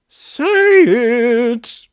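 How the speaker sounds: high, loud roaring command; the beast bellowing its demand; furious beast roar. Acted. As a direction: roar it at him.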